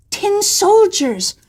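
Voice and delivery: high, slow gleeful cry; a little boy opening his present; delighted child. Acted. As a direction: squeal it with joy.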